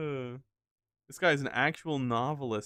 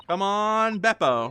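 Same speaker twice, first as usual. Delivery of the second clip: loud, higher call; 7 semitones higher, 8 dB louder, slower than usual. Real, not acted. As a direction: call out to egg someone on. Cheering on a game character.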